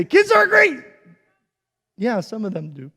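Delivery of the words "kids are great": high, loud and beaming; enthusiastic kids are great. Shown as a demonstration. Preacher to older folks, cheering kids are great. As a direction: sell it to the crowd with joy. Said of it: "but when I say".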